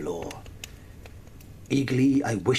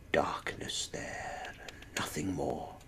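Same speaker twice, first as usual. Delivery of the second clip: whisper; 5 semitones lower, 10 dB quieter than usual. A narrator's reading. Narrator whispering what he finds past the door. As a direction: breathe the words into the dark. Opening door onto darkness.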